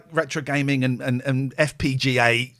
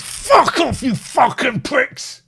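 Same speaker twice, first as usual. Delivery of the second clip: high, loud, slow sweary outburst; swearing tirade; 11 semitones higher, 5 dB louder, slower than usual. Retold or imitated. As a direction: spit the insults out, hammed up. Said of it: "all I can say is".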